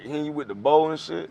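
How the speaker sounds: ordinary talk, nothing marked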